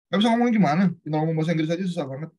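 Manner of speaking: high, loud, fast scolding retort; exasperated boss. Retold or imitated. Boss brushing off his excuse, exasperated and fast. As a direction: snap back at him, impatient and quick.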